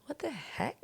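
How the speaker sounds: high, soft "heck" sounded like "hack"; shyly soft, wrong vowel in "heck", nearer "hack"; mispronounced HECK. Retold or imitated. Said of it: "did not sound right".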